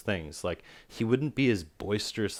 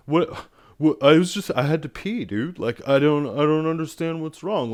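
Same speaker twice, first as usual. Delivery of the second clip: high, loud whiny dude voice; hammed-up clueless tourist; mock Mark; 7 semitones higher, 7 dB louder than usual. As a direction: play him as a clueless manchild whining.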